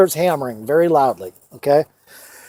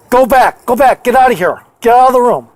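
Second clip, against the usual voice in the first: louder, higher, sharp warning; mother's urgent command; 4 semitones higher, 3 dB louder than usual. Retold or imitated. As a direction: order a child out of danger, urgently. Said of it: "like that".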